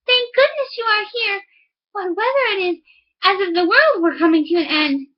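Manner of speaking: a hammed-up sigh of welcome, high; relieved wife. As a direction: overdo the relief to hide her guilt. Wife greets husband falsely.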